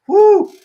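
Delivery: high, loud "whoo"; sudden high-pitched whoop of pleasure; delighted whoop. Retold or imitated. Acts delight at cotton shirts.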